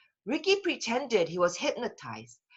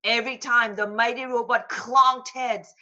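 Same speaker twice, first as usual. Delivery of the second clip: ordinary delivery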